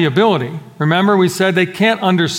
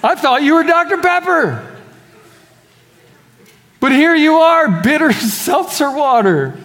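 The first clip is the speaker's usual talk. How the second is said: mock the scoffer's surprise; mocking non-believer; 9 semitones higher than usual. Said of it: "all of a sudden they're like".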